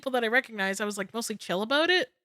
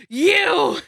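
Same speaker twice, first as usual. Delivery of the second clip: loud, higher pointed "You!"; accusing yell; 6 semitones higher, 8 dB louder than usual. Retold or imitated. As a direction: point and yell at him playfully. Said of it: "I just pointed to him and I just went".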